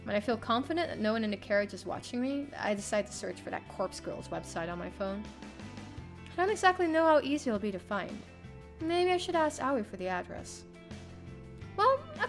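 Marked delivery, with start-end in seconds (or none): none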